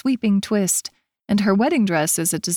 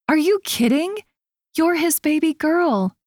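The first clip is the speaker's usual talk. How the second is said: much higher, playful; animated teasing; 9 semitones higher than usual. A narrator's reading. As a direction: tease the worried bride. Reassuring teasing friend.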